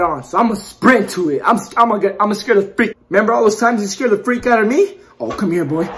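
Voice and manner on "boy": ordinary talk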